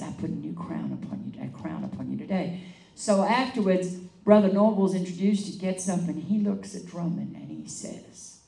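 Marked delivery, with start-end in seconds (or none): 0.0-2.6 deep, solemn voice of God, much lower; voice of the Lord. Retold or imitated. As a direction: intone it low as the Lord crowning her. The Lord speaks in vision.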